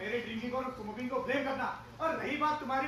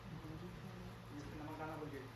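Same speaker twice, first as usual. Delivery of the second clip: soft, slow, low complaint; low grumble; 10 semitones lower, 16 dB quieter, much slower than usual. Acted. Husband grumbling quietly about the unsalted food. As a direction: mutter the complaint under your breath.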